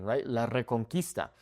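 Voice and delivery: ordinary talk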